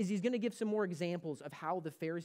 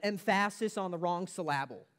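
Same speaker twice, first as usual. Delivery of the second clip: emphasis said em-PHA-sis, syllable said syl-LA-ble, as a joke; stress on wrong syllables; 4 dB louder than usual. Shown as a demonstration. Preacher showing how funny misplaced emphasis sounds, "emphasis" and "syllable" stressed on second syllable. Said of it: "emphasize the wrong part of a word".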